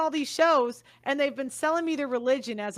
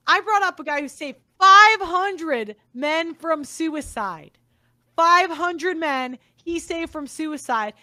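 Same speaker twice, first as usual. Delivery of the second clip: louder, slow, hammering each word; loud slow insistence; 2 semitones higher, 7 dB louder, slower than usual. Real, not acted. Recounting the debate, loud slow insistence. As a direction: drive the number home, loudly. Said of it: "I'm pissed that I".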